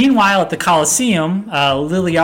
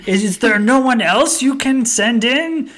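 Gladiator taunt; 8 semitones higher, 3 dB louder than usual. Retold or imitated. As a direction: challenge the arena crowd like Maximus. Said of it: "yells at them like Gladiator".

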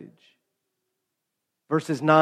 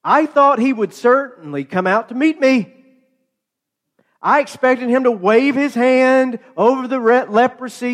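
higher, louder indignant rant; an offended general sputtering in outrage; a hammed-up, affronted complaint; angry Naaman; 6 semitones higher, 4 dB louder than usual. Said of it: "is really angry about this".

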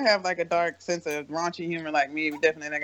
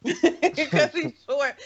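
laughing; 7 semitones higher, much slower than usual. Real, not acted. Laughing at a friend.

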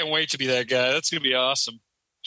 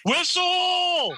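high, drawn-out yell; screamed coach call; 14 semitones higher than usual. Retold or imitated. Coach stopping play without a whistle, screaming. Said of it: "they just scream".

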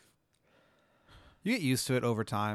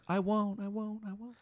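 high, fading ghostly voice; an echo in the dark that dies away; eerie ghost voice; 10 semitones higher than usual. Acted. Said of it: "you just hear".

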